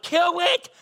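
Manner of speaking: high, loud imitated screaming of a three-year-old; child screaming. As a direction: scream it like a kid standing on his seat. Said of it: "so he's like".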